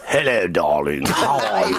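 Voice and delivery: low, hammed-up posh London greeting; mock London luvvie, pitched low; posh London accent. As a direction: put on a plummy London accent. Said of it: "Can you do a London accent, a posh London accent?".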